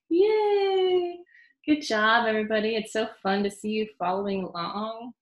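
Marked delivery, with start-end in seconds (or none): 0.1-1.2 high, loud happy cheer. Real, not acted. Cheering the children.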